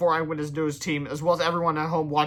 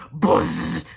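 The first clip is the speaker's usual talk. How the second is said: plain reading voice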